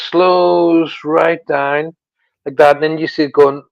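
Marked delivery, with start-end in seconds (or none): 0.1-1.9 slowing down. Shown as a demonstration. Describing the light slowing down.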